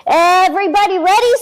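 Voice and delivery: excited Bobby. A narrator's reading. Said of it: "says Bobby".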